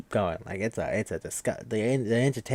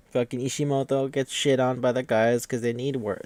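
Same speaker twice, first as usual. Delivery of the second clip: normal talking voice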